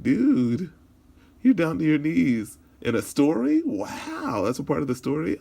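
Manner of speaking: high, loud disbelieving outburst; incredulous friend. Acted. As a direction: act out a stunned buddy's disbelief. Friend reacts in disbelief to him.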